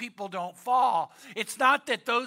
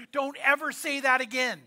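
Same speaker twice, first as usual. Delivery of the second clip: ordinary delivery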